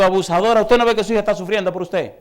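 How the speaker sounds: loud, high scolding rebuke; mock-furious preacher telling off a mother; scolding outburst. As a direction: scold her hard for making her daughter cry.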